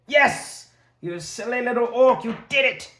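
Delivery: loud, high triumphant shout; excited cheer. Real, not acted. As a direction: celebrate the hit with delight. Cheering a successful hit.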